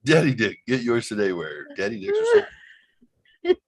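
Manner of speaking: hammed-up sales read; mock ad slogan. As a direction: pitch it like a store commercial. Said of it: "another commercial tag".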